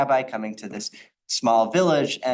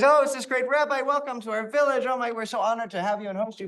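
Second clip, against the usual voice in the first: much higher, gushing villagers' welcome; excited greeting; 10 semitones higher than usual. Retold or imitated. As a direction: fawn over the honored rabbi. Villagers greet great rabbi excitedly.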